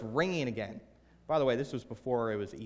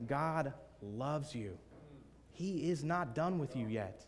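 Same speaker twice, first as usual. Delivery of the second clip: slow, weighted words to the congregation; slow, deliberate address; 4 dB quieter, slower than usual. Real, not acted. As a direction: spell out "He is not done" word by word. Repeats it to the congregation.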